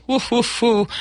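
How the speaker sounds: high playful barking woofs. Pretend. Host introducing the wily wolf, playful high woofs. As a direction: bark like a cheeky wolf.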